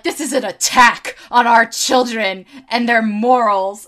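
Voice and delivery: loud mock moral outrage; emphatic, overblown public alarm; alarmed toy expert. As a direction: declaim it like a scandalized pundit. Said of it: "an expert on toys".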